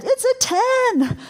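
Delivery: high, slow exaggerated claim; a hammed-up high panicked rating; mock alarm. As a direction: squeak it out like an overstatement. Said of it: "If they said".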